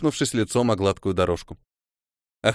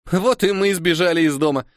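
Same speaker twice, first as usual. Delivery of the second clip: joyful exclaim; 6 semitones higher, 3 dB louder than usual. A narrator's reading. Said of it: "радостно воскликнула Кора".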